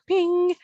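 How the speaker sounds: high-pitched little bell sound; imitated ping. Mom describing her son's sudden breakthrough, high imitated ping. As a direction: ring it out like a chime.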